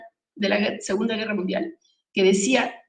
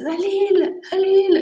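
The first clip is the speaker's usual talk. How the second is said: higher, louder tearful wail of "Dalila"; imitating crying friend; 10 semitones higher, 6 dB louder than usual. Friend comes crying to Dalila, higher mock wail. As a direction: wail her name like a weeping friend. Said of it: "viene llorando y me dice".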